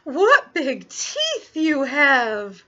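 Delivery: loud, high, very slow amazement; alarmed little girl. Acted. Little Red staring at sharp teeth, slow loud alarm. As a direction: stretch each word in horror.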